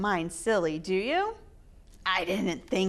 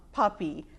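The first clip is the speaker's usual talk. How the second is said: usual speaking voice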